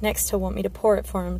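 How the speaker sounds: ordinary delivery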